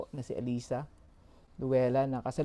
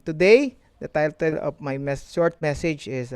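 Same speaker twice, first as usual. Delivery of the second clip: ordinary delivery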